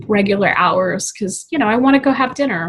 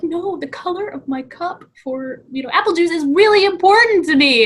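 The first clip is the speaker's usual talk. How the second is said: put-on higher, upset kid pleading; childish plea; 8 semitones higher, 5 dB louder than usual. As a child begging adults about her cup, upset kid voice. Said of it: "I'd be like".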